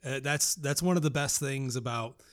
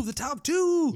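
much higher, louder drawn-out announcement; hyped announcement; 14 semitones higher, 6 dB louder, slower than usual. Acted. Host announcing the top two picks, much higher and louder. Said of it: "announcing the final two".

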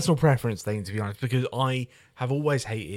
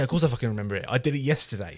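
ordinary talk, nothing marked

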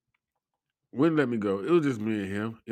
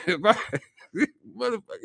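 laughing; 13 semitones higher, 3 dB louder, much faster than usual. Real, not acted. Laughing at the absurd request.

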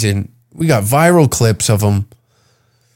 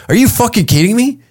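imitated indignation, pitched up high; mad, upset Texan; 9 semitones higher than usual. Texan refused entry over a DUI, mad and upset. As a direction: act out an offended Texan's protest. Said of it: "They get mad, upset".

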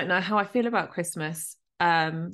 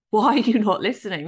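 retold angry protest, higher and louder; exasperated demand; 4 semitones higher, 6 dB louder than usual. Daughter to dead dad who cannot hear, exasperated. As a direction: replay the frustration at being ignored.

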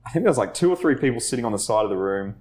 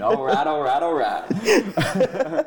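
higher-pitched, hammed-up American film-star accent; comic American accent, pitched up, laughing at the end; Matthew McConaughey; 9 semitones higher, much slower than usual. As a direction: go all in on an exaggerated American impression. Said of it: "I went full Matthew McConaughey again".

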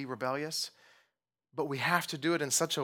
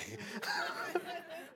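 soft laugh; 8 dB quieter than usual. Real, not acted. Laughs after child quote.

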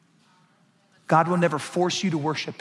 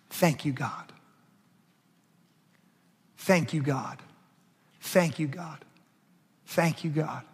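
quiet prayer; 6 dB quieter than usual. Retold or imitated. Retold prayer at hospital.

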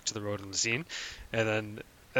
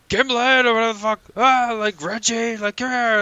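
high, loud mock call to a dog; hollering a dog's name across the park, much higher and louder; imitating a yelling dog owner; 13 semitones higher, 9 dB louder than usual. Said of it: "like, yelling at his dog".

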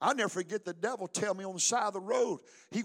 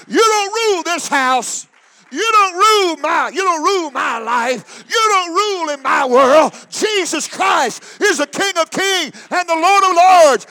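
loud, higher defiant rebuke with a cry in it; shouting down a spirit; 8 semitones higher, 15 dB louder than usual. Pretend. Believer rebukes the spirit over their life, shouting. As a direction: cast it out of the house. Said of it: "tell that spirit".

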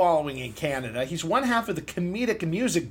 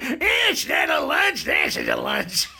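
mock outrage; 13 semitones lower, 3 dB louder, slower than usual. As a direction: ham up a shocked customer. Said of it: "You're like".

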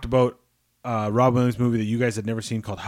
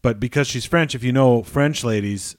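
ordinary talk, nothing marked

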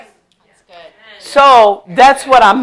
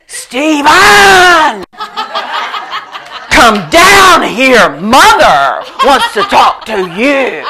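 loud, higher yell up the stairs, laughing; shouted with spin; 5 semitones higher, 5 dB louder, much slower than usual. Retold or imitated. Brother yelling up the stairs, mock alarming shout. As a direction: twist the same words into a summons. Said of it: "put his own emphasis and spin on it".

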